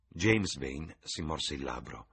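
usual speaking voice

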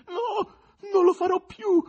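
very high, strained wail; tearful croaking plea. A narrator's reading. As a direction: gasp out a weeping promise. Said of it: "rantolò la testa".

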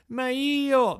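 put-on a scared boy protesting; frightened protest. A narrator's reading. Pinocchio protests his arrest, frightened.

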